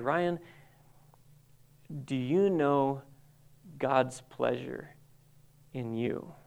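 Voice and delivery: hushed and slow, with weighty pauses; gentle probing question. Retold or imitated. Mentor asking if he knows God's pleasure, gentle probing. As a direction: retell a mentor's searching question, softening toward the end.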